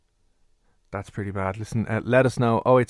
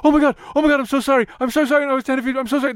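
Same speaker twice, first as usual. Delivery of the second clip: high, gushing, panicked sorry; a mortified interviewer apologising over and over; flustered apology; 4 dB louder, faster than usual. Retold or imitated. Interviewer apologises to him for being late, high and flustered. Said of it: "And she was like".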